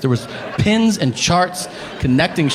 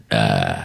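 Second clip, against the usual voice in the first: very low, lazy grunt into the phone; a bored caveman noise instead of hello; dull grunt. Retold or imitated. Man answering a phone call with a low grunt. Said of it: "answers the phone with a noise".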